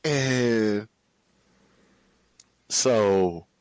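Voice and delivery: normal talking voice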